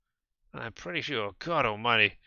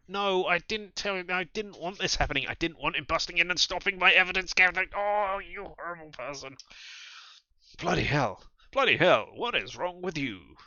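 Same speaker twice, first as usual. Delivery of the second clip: outraged scolding; 6 semitones higher, 4 dB louder than usual. Acted. Kadir appalled at Julia's behaviour. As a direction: scold like you can't believe it.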